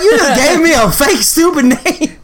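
high-pitched, laughing mock indignation; incredulous protest. Calling out the man who mocked his name, high mock indignation. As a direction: retell it as an outraged, amused protest.

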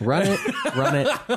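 giggling while chanting; laughing. Real, not acted. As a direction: laugh through the words. Egging on, laughing.

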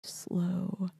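slow, hushed plea; director's note. Retold or imitated. Director giving nightly note to actor, slow and hushed. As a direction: spell it out softly, fading to a whisper. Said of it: "my director would always".

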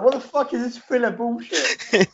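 high, put-on villain voice, fed up; mock exasperated Shao Kahn. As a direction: sneer it like a bored tyrant. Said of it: "And Shao Kahn's like".

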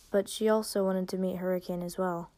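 plain reading voice

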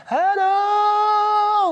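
very high, bright-eyed hello; imitating Carolyn's greeting. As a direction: greet like an overjoyed girl. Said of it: "she'd go".

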